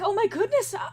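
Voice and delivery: high, urgent worried exclamation; alarmed concern. Acted. Lyrinus rushing to fallen Ariadne, alarmed concern. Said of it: "immediately rushes over and is like".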